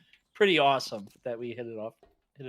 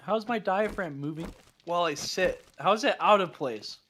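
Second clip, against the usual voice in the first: talking with hiccups; hiccuping between words; 6 semitones higher, 3 dB louder than usual. Real, not acted. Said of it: "I got hiccups".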